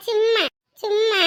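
ordinary talk

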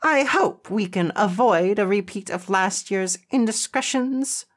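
high, disapproving school principal; prim, sharp reprimand; snapping principal. A narrator's reading. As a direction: scold in a high, officious voice. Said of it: "she snapped".

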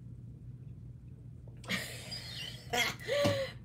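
high breathy laugh. Real, not acted. Bobbin ran out.

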